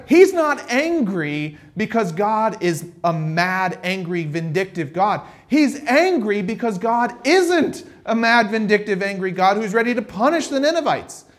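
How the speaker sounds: usual speaking voice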